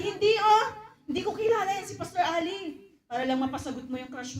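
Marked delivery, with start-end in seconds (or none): 0.0-2.8 flustered denial. Acted. Suitor denying his pastor to win his crush, high flustered voice. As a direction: deny it hard, voice jumping up.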